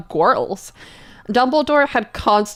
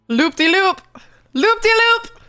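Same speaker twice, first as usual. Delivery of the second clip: much higher, taunting chant; gloating sing-song; 10 semitones higher than usual. Real, not acted. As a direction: crow over the win. Gloating over poll win.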